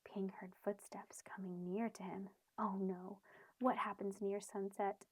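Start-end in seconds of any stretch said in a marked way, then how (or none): none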